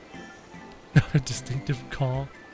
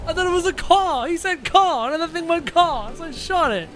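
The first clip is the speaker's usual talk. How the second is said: much higher, agitated self-defence; exasperated protest; 10 semitones higher than usual. Real, not acted. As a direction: plead your case in high-pitched dismay. Protesting a mistake in game.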